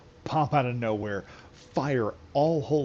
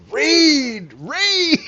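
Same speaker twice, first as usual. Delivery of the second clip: much higher, much louder manic shrieking yell; Uncle Ruckus yelling; 11 dB louder than usual. Retold or imitated. Uncle Ruckus smashing a possessed man with a book, shrieking high yell. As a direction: scream it like a crazed zealot swinging a book. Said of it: "in comes Uncle Ruckus ... he goes".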